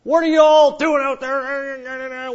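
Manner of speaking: mock old woman yelling at youngsters; cranky old lady. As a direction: scold the young ones like a cane-waving granny. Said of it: "old lady that gets up there and go".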